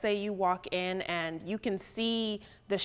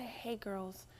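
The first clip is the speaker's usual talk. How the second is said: hushed whisper to the group; very soft, confidential whisper; discreet whisper; 11 dB quieter than usual. Acted. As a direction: whisper a polite, private request. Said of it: "I'll just whisper and say".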